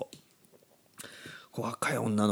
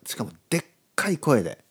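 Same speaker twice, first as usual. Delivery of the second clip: ordinary delivery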